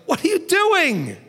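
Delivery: high, slow startled yell; alarmed shout. Retold or imitated. Waking someone up from a bad choice, shouting. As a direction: jolt them awake with it. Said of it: "really needed to wake them up".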